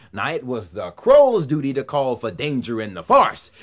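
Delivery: ordinary delivery, nothing marked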